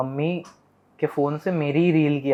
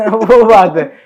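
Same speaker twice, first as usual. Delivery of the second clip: real laugh, much louder and slower, spilling into the words; 6 semitones higher, 11 dB louder, much slower than usual. Real, not acted. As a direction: laugh it out loud, then slow down. Laughing about their mischief. (ज़ोर से हँसते हुए)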